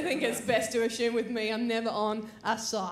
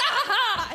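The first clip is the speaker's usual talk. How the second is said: high loud mock "ha ha"; mock taunting laugh; 8 semitones higher, 4 dB louder than usual. Taunting the man on the platform, high gloating laugh.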